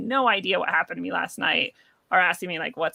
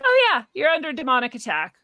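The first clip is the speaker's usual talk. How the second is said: casual matter-of-fact; 5 semitones higher, 4 dB louder than usual. Retold or imitated. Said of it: "they're like".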